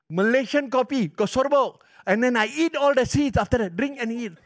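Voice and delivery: high, loud, eager confession; excited. Real, not acted. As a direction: relish every detail with excitement.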